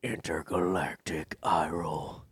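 low, slow, hushed echo; mock sci-fi announcer. Host echoing a co-host's phrase, slow hushed sound effect. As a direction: stretch it out low and whispery.

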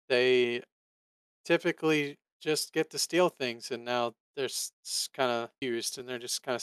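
ordinary talk

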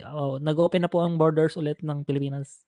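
ordinary talk, nothing marked

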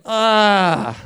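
long, falling weary groan; dismayed groan. Acted. Tired preacher recognised on a plane, dismayed groan. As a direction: let out a drawn-out "ah" of dread. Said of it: "I went".

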